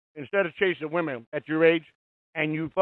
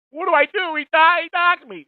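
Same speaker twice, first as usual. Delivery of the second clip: much higher, whining mockery of a scared troll; mock whiny crybaby; 11 semitones higher, 4 dB louder than usual. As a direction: whine it like a tattletale running to daddy. Mocking Ian running to daddy.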